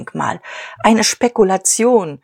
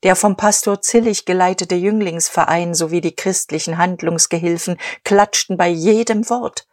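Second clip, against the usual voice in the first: plain reading voice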